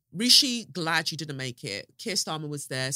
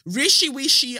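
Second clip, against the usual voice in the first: taunting pet name, pitch leaping up; mocking sing-song; 8 semitones higher, 7 dB louder than usual. Real, not acted. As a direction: tease him with a sing-song nickname. Taunting the absent politician.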